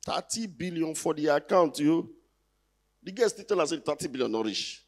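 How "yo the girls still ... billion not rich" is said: ordinary talk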